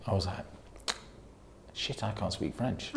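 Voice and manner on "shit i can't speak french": very soft, slow realisation; hushed mock dismay; retold private aside. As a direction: say it under your breath to yourself. Retold panicked aside to herself.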